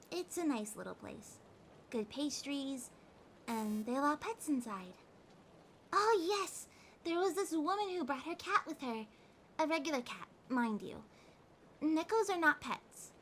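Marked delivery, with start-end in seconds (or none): 5.9-8.6 bright sudden burst, higher and louder; light up at the memory; excited. Acted. Catgirl telling her partner about the cafe cat, excited.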